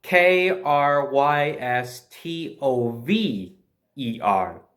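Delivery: slow, deliberate letter-by-letter spelling, K R Y S T O V E R. Shown as a demonstration. Spelling the Russian worker's name for viewers, slow and deliberate. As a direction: spell each letter out slowly and clearly. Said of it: "he spelt his name".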